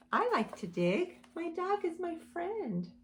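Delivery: normal talking voice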